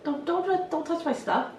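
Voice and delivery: high, fussy mock protest; playful mock warning. Hairdresser messing around with a girl client, playful mock warning. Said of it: "this is what I mean by messing around".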